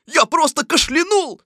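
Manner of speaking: high, loud protest; high-pitched tiger excuse; insistent tiger. A narrator's reading. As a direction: plead innocence in an excitable voice.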